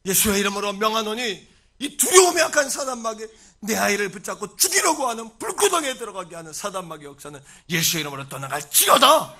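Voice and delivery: loud exorcism command, climaxing in a shout; commanding proclamation. Acted. Parent casting Satan out in Jesus' name, commanding shout. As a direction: order the devil out with full force. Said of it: "그렇게 날마다 선포하며 고백한 거예요".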